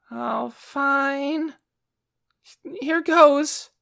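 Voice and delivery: put-on high, weak boyish shout; pathetic little shout. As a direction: psych yourself up feebly. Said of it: "a pretty pathetic little shout".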